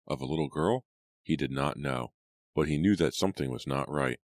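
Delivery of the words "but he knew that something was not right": usual speaking voice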